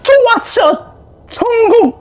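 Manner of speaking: high happy cheer. Real, not acted. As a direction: cheer a win. Cheering at their success.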